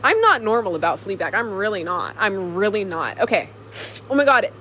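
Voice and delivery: ordinary delivery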